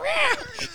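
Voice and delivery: laugh. Real, not acted. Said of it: "laughing after roar".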